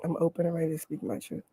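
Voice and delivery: hushed meditative affirmation; soft inner affirmation. Shown as a demonstration. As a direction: say it as if only in your head. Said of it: "So you just say it in your head".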